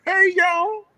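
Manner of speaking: high, loud "hey hey y'all"; loud greeting. Real, not acted. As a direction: greet the crowd big and bright.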